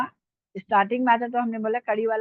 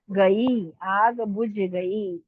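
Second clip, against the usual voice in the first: slow dictation; much slower than usual. Shown as a demonstration. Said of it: "इस पे जेर जबर पेश लिखा नहीं".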